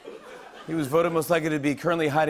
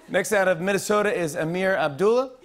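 ordinary delivery

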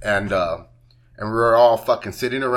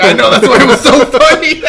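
higher and louder, laughing; 9 semitones higher, 10 dB louder than usual. Retold or imitated. Quoting his coworker's text, laughing. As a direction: laugh as you quote her. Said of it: "she was like".

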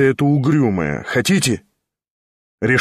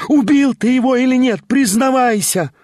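very high, louder accusing scream; frenzied shout; 12 semitones higher, 3 dB louder than usual. A narrator's reading. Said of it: "вскричала она в исступлении".

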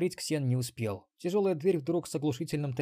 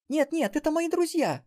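put-on much higher, loud girl's cry; girl's wail; 6 dB louder than usual. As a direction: cry out to save friends. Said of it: "завопила внучка".

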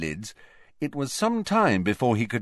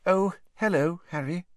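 higher, tight brittle greeting; 9 semitones higher than usual. A narrator's reading. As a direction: keep the voice thin and tight. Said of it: "she said in a brittle voice".

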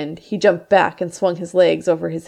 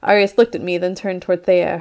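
ordinary delivery, nothing marked

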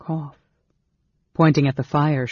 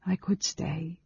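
soft voice; 3 semitones higher, 7 dB quieter than usual. A narrator's reading. Elf woman to the sleeping mage, softly. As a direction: tell him gently, barely disturbing him. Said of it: "she told him softly".

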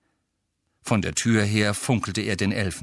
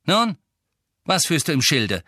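raised, higher impatient questioning; brusque; 8 semitones higher, 5 dB louder than usual. A narrator's reading. Master questioning the suspect elf, brusque. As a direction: press the elf gruffly for an answer. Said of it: "fuhr ihn Sirius unwirsch an".

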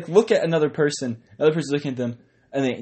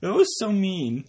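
whiny, higher, slow complaint; mock hurt; 7 semitones higher than usual. Real, not acted. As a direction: friend pretending to be offended by a joke.

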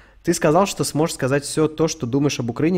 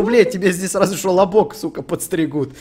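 excited rant; 5 semitones higher, 8 dB louder than usual. Real, not acted. Excited rant about cheap haircuts.

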